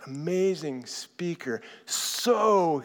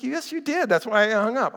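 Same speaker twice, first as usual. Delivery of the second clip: arguing wife; 8 semitones higher than usual. Retold or imitated. Wife arguing back, retold quarrel.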